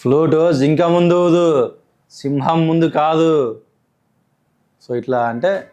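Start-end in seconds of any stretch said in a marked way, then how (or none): 0.0-3.6 slow, loud punch-dialogue delivery; slow heavy hero punch line; film hero dialogue. Shown as a demonstration. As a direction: deliver it like a mass movie hero, slowly and weighty. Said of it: "అలా ఒక dialogue చెప్పండి".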